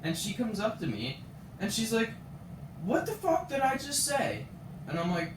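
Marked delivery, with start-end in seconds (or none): 2.8-4.5 high, indignant mock scolding; imitated angry swearing. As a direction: act out an outraged librarian. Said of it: "swearing in my face".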